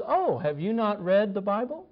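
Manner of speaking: a touch of smug, rising Oh; lightly imitated smug reply; mocking back. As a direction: throw their tone right back at them. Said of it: "responding to them in kind".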